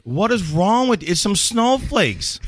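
high, drawn-out incredulous scolding; stretched, high-pitched exasperation; exasperated rant. Acted. Acting out exasperation at a driver.

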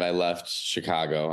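usual speaking voice

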